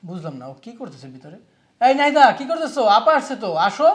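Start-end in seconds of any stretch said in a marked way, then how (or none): 1.8-3.9 loud, higher call through the door. Acted. Husband calls his wife out to greet his sister, loud call. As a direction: raise the voice to fetch her.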